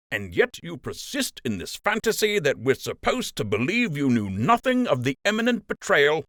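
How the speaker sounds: accusing captain. Acted. Captain accusing Wilfred of betrayal, hard accusing tone. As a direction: press the charge in his face.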